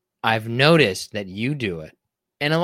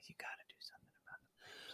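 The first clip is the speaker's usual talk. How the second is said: whisper; 29 dB quieter than usual. Real, not acted. Teasing aside.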